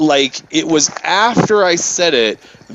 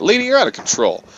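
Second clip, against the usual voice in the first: retold accusation; 6 semitones higher than usual. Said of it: "I think it said it like that".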